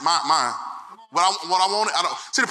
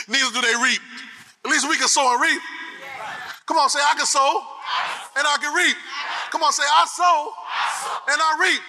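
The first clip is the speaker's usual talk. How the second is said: preaching at full force; 4 semitones higher than usual. Acted. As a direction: drive the scripture home to the congregation.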